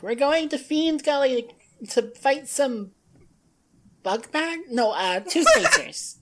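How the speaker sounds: yelling back. Acted. Avery answering while walking away, playful yell. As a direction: call back over your shoulder, fumbling for the name. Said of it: "Avery's gonna yell back".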